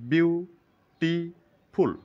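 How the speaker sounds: বিউটিফুল said very slowly, split into বিউ, টি, ফুল; syllable by syllable. Shown as a demonstration. Teacher counting syllables aloud for students, very slow. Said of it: "এখানে তিনবার উচ্চারণ করলাম".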